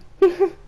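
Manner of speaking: high squeal of a laugh; squeaky cringing giggle. Real, not acted. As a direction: laugh out of sheer embarrassment.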